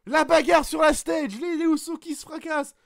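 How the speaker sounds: play-by-play high and loud, going up; commentaire survolté. Acted. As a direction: commente le match comme en direct, à fond. Commentary of the match. (commentateur de catch surexcité)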